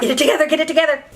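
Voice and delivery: rapid-fire pep talk to yourself; quick, urgent muttered-to-self quote; hurried self-talk. Retold or imitated. Acts out pep talk to herself.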